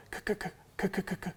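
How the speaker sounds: fast unvoiced throat clicks; feed call clicks. Shown as a demonstration. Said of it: "all you're doing is going".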